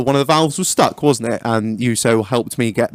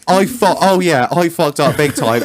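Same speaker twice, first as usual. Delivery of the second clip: usual speaking voice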